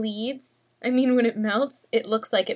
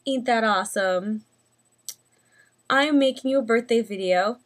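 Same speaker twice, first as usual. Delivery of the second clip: ordinary talk, nothing marked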